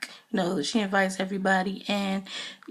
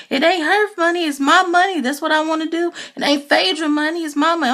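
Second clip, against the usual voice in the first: high, louder, indignant mock voice; imitating indignant wife; 7 semitones higher, 4 dB louder, faster than usual. Wife telling the group the money is hers, high indignant mock. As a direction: insist it's her money, up high and loud. [name]'s wife defends spending.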